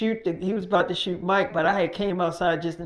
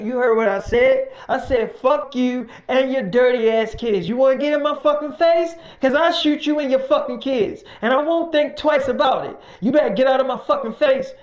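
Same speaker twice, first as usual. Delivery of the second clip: loud, higher threatening yell; angry threat; 5 semitones higher, 5 dB louder than usual. Retold or imitated. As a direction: threaten to shoot him. Mike threatening the worker.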